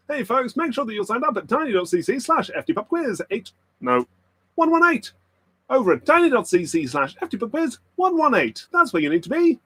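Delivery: squeaky high announcer plug. Pretend. Streamer plugging quiz link in high voice. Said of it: "That's the voice".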